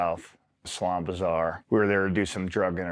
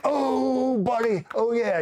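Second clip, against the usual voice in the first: very high, drawn-out delighted crowing; gleeful buddy; 3 dB louder, much slower than usual. Retold or imitated. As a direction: imitate a buddy overjoyed at your misfortune. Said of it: "he's so happy".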